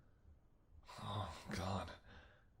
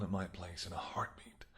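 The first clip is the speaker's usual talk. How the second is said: whisper; 3 semitones lower, 7 dB quieter than usual. Acted. Flirting with hunter.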